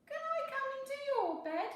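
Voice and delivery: high, fast little mouse plea; quick high squeaky question; pleading Little Mouse. A narrator's reading. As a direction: beg to be let into the bed. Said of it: "asked Little Mouse".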